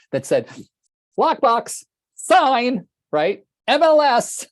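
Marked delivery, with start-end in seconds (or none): 1.2-4.4 high, loud, punchy list of selling points; passionate listing. Retold or imitated. Realtor pitching to the seller, each point loud and high. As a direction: sell each item with passion.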